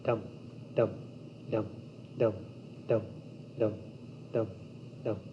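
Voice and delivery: steady, evenly spaced "dum" beats; imitated drumbeat. Shown as a demonstration. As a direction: tap out a heartbeat with the voice, one short dum at a time. Imitating drum beat, like heartbeat.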